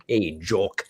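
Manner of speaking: lightly put-on Scottish accent. Shown as a demonstration. Host demonstrating his voice for Scottish players, mock Scottish accent. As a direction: toss off a quick mock Scottish greeting. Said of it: "Scottish accent".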